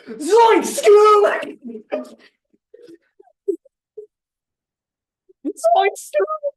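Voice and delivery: high shouted Shaggy yelp; Shaggy impression. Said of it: "That was the impression".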